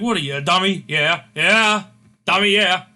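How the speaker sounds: mock-berate him, loud and scornful; berating. Eli Drake berating his servant Cameron, loud and scornful. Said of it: "berating Cameron Grimes, his servant".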